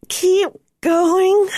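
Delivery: urging through gritted teeth. A narrator's reading. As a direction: push the words through clenched teeth. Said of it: "urged through tightly gritted teeth".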